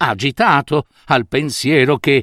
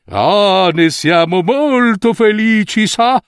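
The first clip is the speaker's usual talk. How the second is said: pleased swindlers; 6 semitones higher, slower than usual. A narrator's reading. The two swindlers flattering the minister, higher and smug. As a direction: sound smugly satisfied. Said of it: "dissero i due tessitori".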